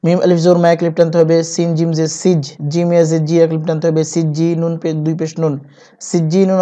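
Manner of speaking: normal talking voice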